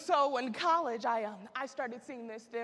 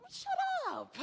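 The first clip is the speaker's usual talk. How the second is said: playful shut up. Pretend. Teasing denial of phone addiction.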